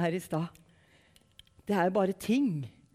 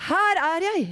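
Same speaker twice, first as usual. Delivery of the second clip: high, loud eager answer; calling out in answer; 9 semitones higher, 7 dB louder than usual. Acted. Boy Samuel answering the Lord's call, loud call. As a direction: call it out as young Samuel replying. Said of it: "Han svarte".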